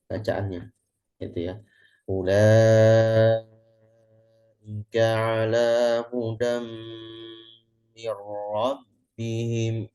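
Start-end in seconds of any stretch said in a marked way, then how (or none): 2.1-9.9 very slow, drawn-out Quran recitation; slow tahqiq recitation. Shown as a demonstration. Teacher reciting slowly as a tahqiq example. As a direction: recite each word slowly. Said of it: "Nah, itu kan tahqiq".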